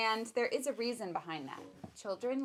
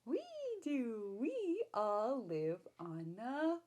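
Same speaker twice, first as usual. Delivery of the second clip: slow, higher sing-song question and answer; reading to a baby; 3 semitones higher, 5 dB louder, slower than usual. Acted. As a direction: draw the baby in with each animal. Reading picture book to baby.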